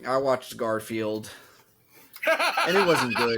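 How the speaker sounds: slow, sheepish confession; reluctant, embarrassed admission; ashamed. Real, not acted. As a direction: own up to it with real shame. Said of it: "The shame in your voice".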